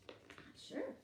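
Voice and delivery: secretive whisper. Acted. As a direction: breathe it out unvoiced. Storyteller builds suspense.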